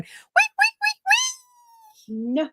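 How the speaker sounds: very high squeaky wait wait; imitating Piggy. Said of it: "he's riding around going".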